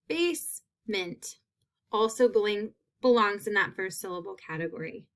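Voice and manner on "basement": high, soft, slow "Basement" with long A held; slow model word. Shown as a demonstration. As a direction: stretch the word for learners. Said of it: "is that long A".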